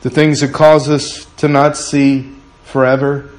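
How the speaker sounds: loud, ringing pulpit preaching; full-force preaching. Acted. Pastor preaching boldly to the congregation, loud and fervent. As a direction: preach it out to the whole church.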